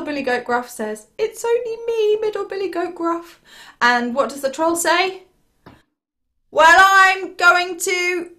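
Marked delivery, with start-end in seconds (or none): 1.2-3.4 high-pitched goat voice; middle billy goat. Acted. Middle billy goat answers the terrible troll, high and meek. 6.5-8.3 slow, loud, raised troll threat; menacing troll. Acted. Terrible troll threatens the little goat, slow and loud.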